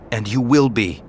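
plain reading voice

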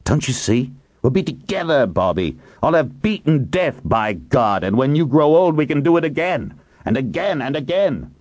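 high-pitched, exultant raving; fervent pleading. A narrator's reading. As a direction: pitch climbing with a visionary's zeal. Hiram raves about beating death.

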